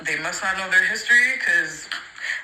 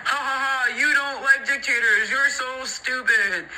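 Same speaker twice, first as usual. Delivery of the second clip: higher, sneering mock laugh then a slow jeer; mocking taunt; 6 semitones higher than usual. Mimicking fascists jeering at Polish people, mocking sneer. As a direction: taunt them like a schoolyard bully.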